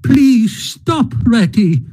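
high, pleading cry; pleading with a friend who won't wait; anguished plea. Acted. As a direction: beg him to stop, heart aching. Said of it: "pleaded the poor mole in anguish".

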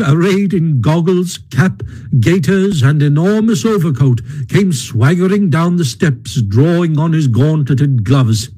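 ordinary delivery

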